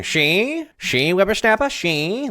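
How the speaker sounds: put-on high, scandalized old-time voice; nineteen-twenties old-timer. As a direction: act like an indignant old-timer. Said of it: "If it was the nineteen twenties, what would you be doing?".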